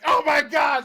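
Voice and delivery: high yelled panic, imitating; mock panicked yell. Retelling a buddy's panic to friends, mock yelling. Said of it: "he just starts yelling".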